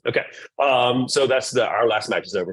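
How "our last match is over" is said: ordinary delivery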